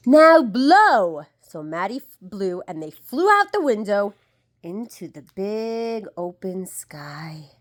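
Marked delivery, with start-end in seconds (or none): 0.1-1.3 loud command. A narrator's reading. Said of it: "The crocodile said". 4.6-7.5 hushed wonder. A narrator's reading. Reading softly to child.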